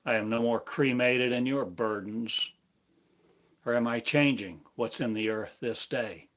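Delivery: ordinary talk, nothing marked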